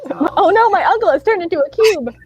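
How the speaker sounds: high, loud comic dismay; mock alarm. Voicing Wax's alarm at the box.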